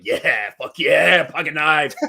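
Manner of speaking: put-on drawn-out, hyped-up teen cheer; slow, punchy mock teenage excitement; excited thirteen-year-old. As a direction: imitate your younger self crowing over a prize. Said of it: "like, thirteen".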